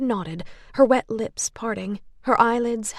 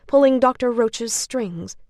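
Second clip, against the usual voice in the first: ordinary delivery, nothing marked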